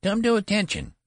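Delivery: high, loud treble command; attempted thunder. A narrator's reading. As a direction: try to thunder in a boyish squeak. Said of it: "he tried to thunder".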